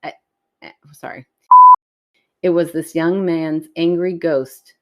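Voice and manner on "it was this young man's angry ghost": oddly slow. Real, not acted. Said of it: "I said that weird".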